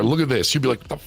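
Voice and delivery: normal talking voice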